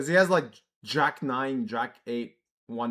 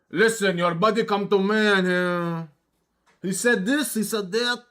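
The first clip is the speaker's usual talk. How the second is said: mild imitated Russian accent, louder; retelling the bar owner's pushy Russian talk; Russian guy; 4 semitones higher, 3 dB louder than usual. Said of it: "like, a Russian guy".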